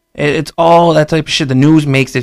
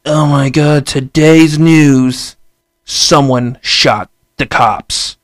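slow, louder mock newscaster drama; mock news anchor; 4 dB louder, much slower than usual. News anchor selling the headline to viewers, slow mock drama. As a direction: hype the headline like a sensational anchor, drawn out. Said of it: "the news be like".

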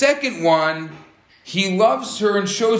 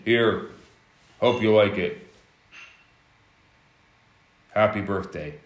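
lower, dull and indifferent, a mock careless handover; no emotion; 8 semitones lower than usual. Husband giving his wife a gift, emotionless and lower. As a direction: hand it over like it means nothing. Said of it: "no emotion".

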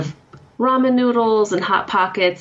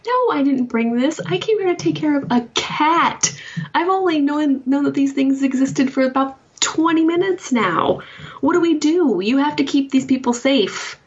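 put-on much higher, pleading young woman; much higher, urgent character voice; alarmed Lori; 10 semitones higher than usual. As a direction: play Lori as a frantic newcomer.

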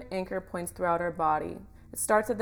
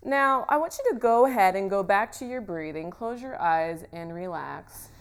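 guided meditation; 6 dB louder than usual. Acted. Leads a guided visualisation.

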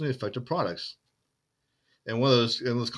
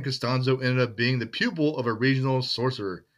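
normal talking voice

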